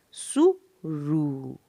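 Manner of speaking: सुरु slowly split into सु and a long रु; slow teaching demo of सुरु in two parts; syllable by syllable. Shown as a demonstration. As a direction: sound out सु, pause, then stretched रु. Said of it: "मैं पढ़ के सुनाऊँगी".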